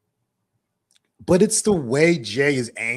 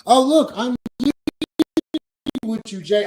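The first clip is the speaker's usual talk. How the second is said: higher, louder fake-friendly voice; a sarcastic chummy imitation; mock cheerful; 9 semitones higher, 6 dB louder than usual. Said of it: "It's actually at the Royal Rumble".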